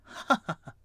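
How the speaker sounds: high tinkling laugh from behind; a light, mocking giggle; fey laughter. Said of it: "you hear [name] laughing behind".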